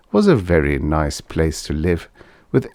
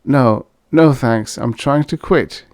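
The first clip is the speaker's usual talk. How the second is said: lame lie; 6 semitones higher, 3 dB louder, slower than usual. A narrator's reading. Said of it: "he lied lamely".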